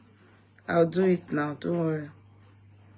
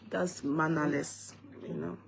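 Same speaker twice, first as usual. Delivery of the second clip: tired yawning; 2 semitones lower, 16 dB quieter than usual. Real, not acted. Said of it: "sorry, I'm tired".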